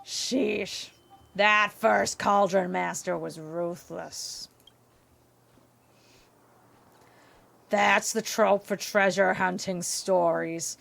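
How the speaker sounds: slow, croaky monster-clerk drone; drawn-out deadpan cartoon croak; Roz. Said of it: "Do you mean Roz from".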